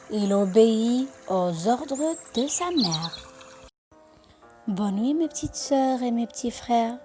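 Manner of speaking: usual speaking voice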